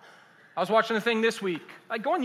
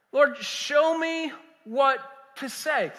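slow, higher-pitched plea to God; crying out in prayer; 6 semitones higher, much slower than usual. Retold or imitated. Preacher crying out to the Lord before teaching, slow pleading. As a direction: plead it slowly, word by word. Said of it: "crying out to the Lord".

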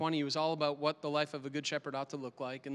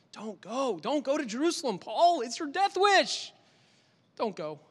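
rising plea; 6 semitones higher, 4 dB louder than usual. Acted. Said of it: "they pleaded and they begged".

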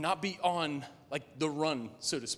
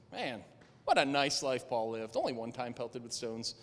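dry sarcasm; 9 semitones lower, 9 dB quieter than usual. Real, not acted. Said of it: "sarcastic comment on Paul".

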